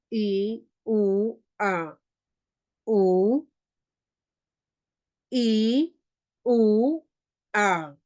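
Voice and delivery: i, u, a said one by one, clearly and apart; teacher sounding out short vowels; short vowels demo. Shown as a demonstration. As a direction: spell out i u a like a teacher. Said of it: "Así suenan".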